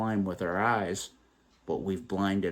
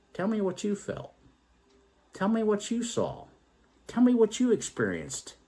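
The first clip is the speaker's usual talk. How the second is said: gentle invitation; 11 semitones higher than usual. Pretend. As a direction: ask openly and kindly, pitched up. Imagined gentle question to others.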